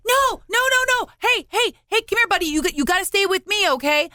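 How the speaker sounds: put-on high, urgent calling after a child; acted alarm. Grandparent to running child, stay with me, alarmed.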